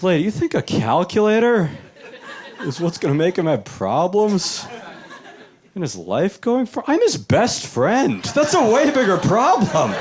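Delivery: mock exasperated retort. Tutor scolding the homeschool mother, high and incredulous. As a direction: let her have it, disbelieving.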